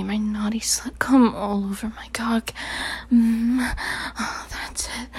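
ordinary talk

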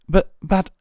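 high, loud, stumbling protest; flustered splutter. A narrator's reading. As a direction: splutter in disbelief. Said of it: "she spluttered".

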